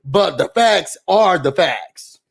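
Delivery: slow, deliberate stress on facts; firm, slow verdict; emphatic. Real, not acted. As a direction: lay down the law, slowly. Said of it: "emphatic verdict".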